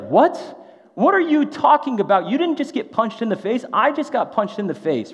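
high, loud incredulous outburst; indignant and loud, voice shooting high; outraged protest. Retold or imitated. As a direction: protest in disbelief, pitch jumping up. Acts out Brandon's outraged protest.

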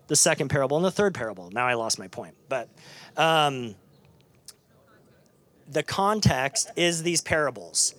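usual speaking voice

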